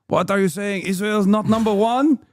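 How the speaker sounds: mocking Israeli commenter, climbing to a high drawn-out shout; hammed-up Israeli accent, rising into an outraged squeal. Mocking a pro-Israel commenter in a hammed-up Israeli accent. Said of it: "pretty good Israeli accent".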